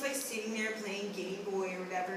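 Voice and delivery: usual speaking voice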